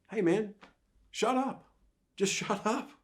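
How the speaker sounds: high mock "shut up" with a laugh; playful scolding. A friend telling an adult to shut up, high and laughing. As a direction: tell a friend off, half laughing. Voicing someone telling you off.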